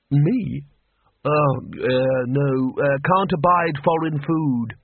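ordinary talk, nothing marked

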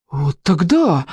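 drawn-out amazed exclamation; exclaiming. A narrator's reading. Petya realizes who the man is, exclaiming. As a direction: blurt out the realization. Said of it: "воскликнул Петя".

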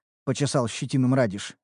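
plain reading voice

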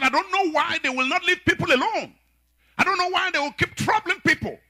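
imitating an angry preacher, high and strained; high-pitched indignant tirade. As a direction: rail against the wicked in a high voice. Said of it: "And he was angry".

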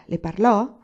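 quick, keen question rising at the end; lively question. A narrator's reading. Old aunt questions Anania about the woman, lively. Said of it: "chiese vivacemente zia Grazia".

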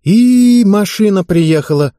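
excited exclamation. A narrator's reading. Hurrying at the doorbell.

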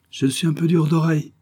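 put-on high, smiling old notary; charming retort. A narrator's reading. Hard-of-hearing notary to the commissioner, smiling retort. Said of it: "riposta le notaire avec un charmant sourire".

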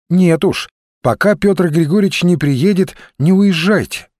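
insist, between a request and an order; half-pleading demand. A narrator's reading. Olga tells him not to leave yet, half demanding. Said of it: "то ли потребовала она".

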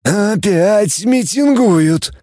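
high, very drawn-out complaint; slow, high-pitched disdain; disdainful grumble. A narrator's reading. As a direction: stretch it out in a high character voice. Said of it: "процедил Гум".

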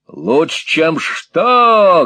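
loud, high rising question; barked. A narrator's reading. Squeers questions hard-of-hearing Peg, barking loudly. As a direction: bark the question at her. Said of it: "гаркнул Сквирс".

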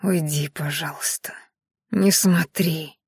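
soft, low-energy plea forced out weakly; quiet hiss. A narrator's reading. Dying Maiari begs Viedash to leave, quiet hiss. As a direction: hiss it softly, slowly toward the end. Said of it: "тихо зашипела".

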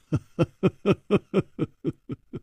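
staccato chuckle trailing down; amused character laugh. A narrator's reading. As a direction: laugh in a light, even run that fades. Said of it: "张啸林笑着说".

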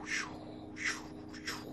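drawn-out whooshing sciù, fading to a whisper; shell whistle imitated. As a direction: imitate the incoming shell, slow then breathy. Incoming shell imitated in narration. (sibilo di granata imitato, lento e poi sussurrato)

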